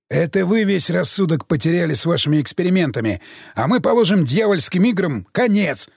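plain reading voice

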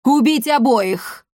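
emphatic, drawn-out villain's order; imperial order. A narrator's reading. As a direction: order it forcefully, stretching each word. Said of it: "мрачно бросил император".

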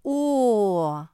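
long, loud, high "oh"; drawn-out. A narrator's reading. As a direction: stretch it out in a loud, falling glide. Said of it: "протянула Мафи".

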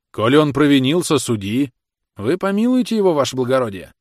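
loud entreaty, leaping high midway; pleading shouts. A narrator's reading. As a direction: plead with an official over the crowd. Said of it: "кричала и уговаривала его". (громко уговаривает, упрашивает)

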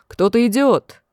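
restrained call of alarm; sudden cry. A narrator's reading. Sentry on the wall warns that someone is coming, mild shout. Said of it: "вдруг закричал".

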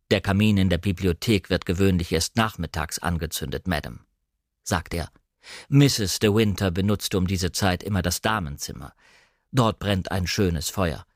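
a stiff, dignified household servant; formal butler. Acted. Narrator voices the butler.

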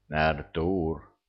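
plain reading voice

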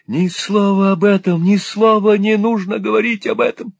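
put-on a robbed old man shrieking in panic; frantic old miser. A narrator's reading.

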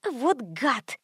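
muted, soft angry mutter; hissed annoyance. A narrator's reading. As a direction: curse him under your breath. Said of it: "зашипела Карц".

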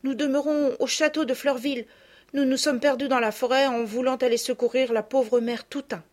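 put-on a small girl explaining herself; little girl's voice.

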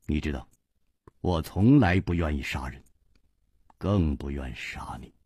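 低沉轻声，满是不忍; 一句一顿，轻轻叹出; 叹息着说. A narrator's reading. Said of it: "长叹说". (无奈低语，声音下沉)

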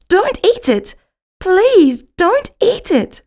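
high, loud desperate begging, slowing on the second plea; pleading cry. A narrator's reading. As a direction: beg him not to eat it. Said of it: "cries Pinky on the shelf".

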